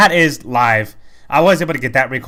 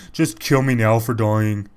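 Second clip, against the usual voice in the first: slow, mock-gloomy minion voice; emo minion; 2 semitones lower, slower than usual. Quoting minion from an earlier fight, slow mock emo. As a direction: imitate a self-pitying minion, dragging it out. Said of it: "they're very emo and suicidal".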